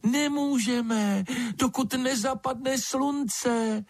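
very high, slow little creature; tiny storybook bat refusing; thin bat voice. A narrator's reading. Bat's thin voice answers the lizard.

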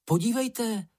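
high-pitched excited call; high young character's cry; exclaiming. A narrator's reading. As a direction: call out high, without much extra volume. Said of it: "vykřikl Mumínek".

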